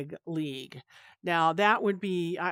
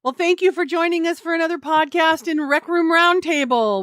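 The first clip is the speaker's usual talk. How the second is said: loud, high show sign-off; announcer voice; 8 semitones higher, 9 dB louder than usual. Acted. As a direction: sign off like a radio host. Podcast sign-off.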